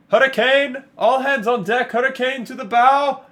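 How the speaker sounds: high, loud alarm cry from the crow's nest; sailor's shout. Acted. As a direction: bellow the warning down to the deck. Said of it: "you hear the sailor shout down".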